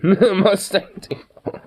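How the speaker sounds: laughing through the words, very slow. Shown as a demonstration. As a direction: giggle it out slowly.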